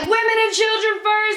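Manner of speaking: high, mock gallant captain's cry; a hammed-up hint of a ship's officer; mock heroic call. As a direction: play the noble hero on a sinking ship. Mock Titanic lifeboat scramble.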